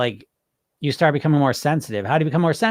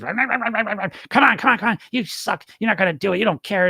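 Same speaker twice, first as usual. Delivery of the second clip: high, badgering mock parent; hammed-up scolding voice; miserable inner critic; 6 semitones higher than usual. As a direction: nag and berate like a disapproving parent. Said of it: "the voice trying to get you to lose weight is a miserable bastard".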